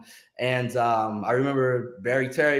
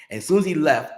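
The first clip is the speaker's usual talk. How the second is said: ordinary talk, nothing marked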